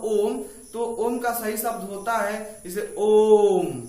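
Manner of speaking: ओम held long, the vowel stretched to three beats; drawn-out Om. Shown as a demonstration. Teacher showing students the three-beat ओम, held long. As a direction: draw out ओम like a chant. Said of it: "इसमें और ज्यादा समय लगता है".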